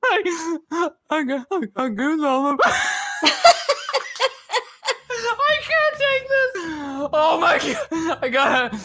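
high, broken words through laughing; helpless laughter. Real, not acted. Reacting to the rapper, can't stop laughing. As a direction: try to speak but keep cracking up.

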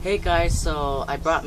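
fake on-camera voice. Real, not acted. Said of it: "I'm acting fake right now".

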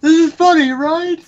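high, loud mocking voice; mocking impression. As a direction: mimic him, high and smug. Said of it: "Corpulent was like".

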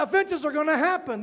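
usual speaking voice

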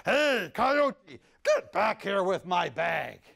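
loud stern demand; angry call. Acted. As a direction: order the thief to come back. Creator chases the thief.

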